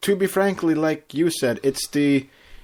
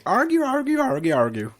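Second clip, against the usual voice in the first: sing-song high argue chant; bouncy chant, first argue jumps high; quoting a cartoon pony chant; imitating Pinkie Pie; 11 semitones higher than usual. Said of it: "joining them and saying".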